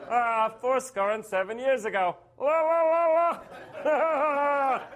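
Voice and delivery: mock squeaky Lincoln voice; high-pitched Lincoln warbling. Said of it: "He'd be like".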